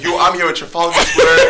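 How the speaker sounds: high, loud selfie-video announcement; mock-thrilled tourist shout; excited boast. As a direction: crow to the camera, hammed up. Acts out own proud mistaken selfie recording.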